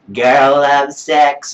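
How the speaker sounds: mocking lyric impression. Critic ridiculing the song's worst line, loud slow mocking. Mocks the lyric once more.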